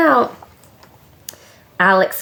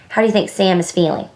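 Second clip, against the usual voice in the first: ordinary delivery, nothing marked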